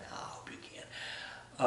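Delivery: slow unvoiced whisper. Shown as a demonstration. Repeated as a whisper.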